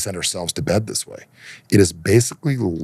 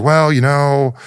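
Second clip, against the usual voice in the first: higher, louder stalling "well, you know"; mock hedging, pitched up; imitated stumped agent; 7 semitones higher, 7 dB louder, much slower than usual. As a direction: play an agent stuck for what to do. Acting flustered support person backing down.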